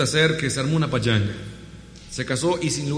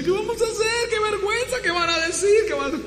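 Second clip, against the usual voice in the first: much higher, frantic mock panic; kitchen panic. Kitchen staff panicking that the wine ran out, frantic. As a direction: squeal it like panicking cooks.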